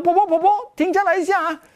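high, urgent call down the street. Retold or imitated. Young man chasing grandma along the road, urgent high call. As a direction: call after her to stop. Running after old woman calling.

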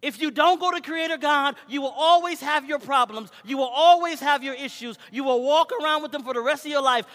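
loud, high preacher's cry; fiery preaching. Acted. Preacher crying out to the church, loud and high. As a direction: preach it hard to the congregation.

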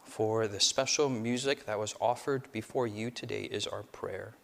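low, soft prayer; hushed pastoral prayer. Acted. Opening prayer, held low and soft.

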